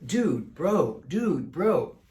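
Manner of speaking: imitating a dude-bro crew with swooping pitch; mock dude-bro. Describing the Ghost Adventures crew's style, mock dude-bro. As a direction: put on a bit of dude-bro talk. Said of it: "Their style is not my style. Their style is a little more".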